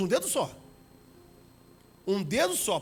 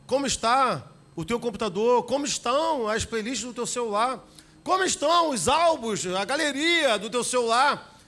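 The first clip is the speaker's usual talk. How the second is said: higher, louder pulpit questions; rising preaching; 4 semitones higher, 5 dB louder than usual. Acted. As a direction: press the congregation. Preaching at full force.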